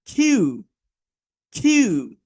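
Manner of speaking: "কিউ" with a puff of air, falling; model pronunciation. Shown as a demonstration. Teacher shows students the word, model pronunciation. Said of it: "এটা উচ্চারণ করার জন্য আপনাকে কি করতে হবে".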